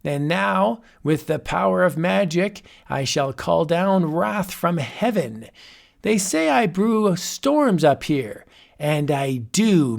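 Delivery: boastful wizard. Acted. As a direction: savour each threat.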